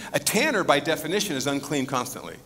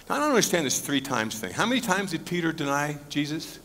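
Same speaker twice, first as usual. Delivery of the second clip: ordinary talk, nothing marked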